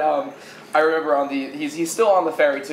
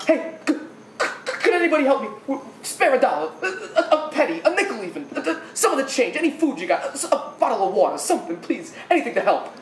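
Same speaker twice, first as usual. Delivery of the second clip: higher, stammering plea for change; pleading beggar; 7 semitones higher than usual. Retold or imitated. Beggar asking train riders for spare change, pleading. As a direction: imitate a panhandler, halting and pleading.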